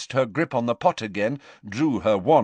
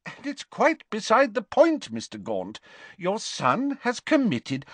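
higher, insistent official; lightly voiced official; 11 semitones higher than usual. Official returning to the son's offence, insistent. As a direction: steer back to the point formally.

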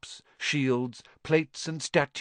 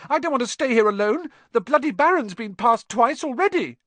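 put-on high, loud, squeaky boy; frightened plea; 12 semitones higher, 6 dB louder than usual. A narrator's reading. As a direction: plead in a high-pitched panic. Frightened boy pleads, scrambling up.

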